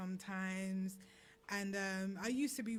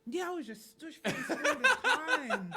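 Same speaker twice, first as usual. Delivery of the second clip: laughing; 9 semitones higher, 9 dB louder than usual. Real, not acted. Laughing about her stush face.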